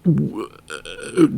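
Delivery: ordinary delivery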